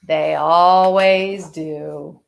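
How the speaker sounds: put-on reading a picture book to children; story narration. A narrator's reading. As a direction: tell it gently to the class. Teacher reads picture book aloud.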